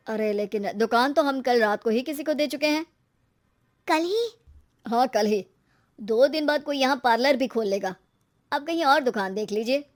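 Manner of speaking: male shopkeeper turning a customer away; matter-of-fact shop owner. Acted. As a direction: play the landlord breaking news without apology.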